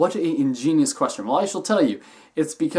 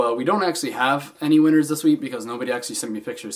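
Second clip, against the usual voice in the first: ordinary talk, nothing marked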